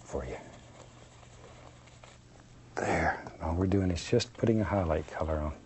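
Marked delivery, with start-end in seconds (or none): none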